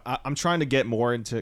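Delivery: ordinary delivery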